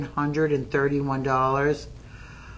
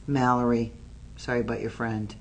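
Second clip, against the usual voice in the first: normal talking voice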